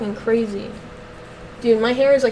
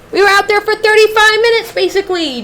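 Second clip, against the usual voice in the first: higher, louder exasperation; exasperated venting; 8 semitones higher, 8 dB louder than usual. Real, not acted. Frustrated dog owner venting to the stream, loud and exasperated. Venting about the dog's walk.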